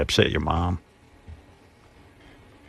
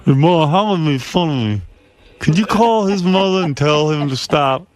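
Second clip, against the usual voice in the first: mocking whiny kid; 6 semitones higher than usual. Mock whiny son calling mom.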